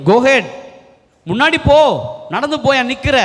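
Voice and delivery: high-pitched, insistent order to move; urgent command. Acted. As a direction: quote God urging a hesitant leader onward. God orders Moses to move forward.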